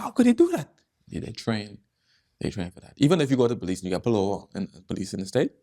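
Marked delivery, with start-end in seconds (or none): 0.0-0.7 amazed. Retold or imitated. Amazed question in retold scene.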